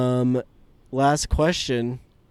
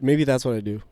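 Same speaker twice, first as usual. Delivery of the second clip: ordinary talk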